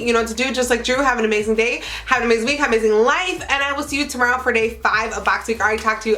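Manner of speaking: usual speaking voice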